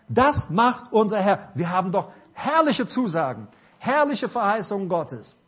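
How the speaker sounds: preacher shouting out scripture promises; preaching at full force. Acted. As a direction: proclaim the promises like a revival preacher. Preaching climax on Bible promises.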